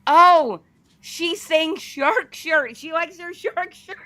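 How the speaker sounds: high-pitched acted "oh!" of sudden understanding; dawning realization. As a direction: translate it for everyone as it finally clicks. Retold moment of sudden understanding.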